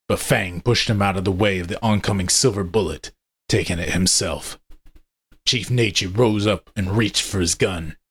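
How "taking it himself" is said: usual speaking voice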